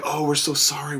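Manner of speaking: lightly mocking sorry voice; a touch of fake company regret; mocking the company's update; mock apology. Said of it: "so now they're like".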